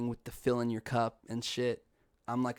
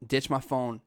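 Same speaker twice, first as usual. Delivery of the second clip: plain reading voice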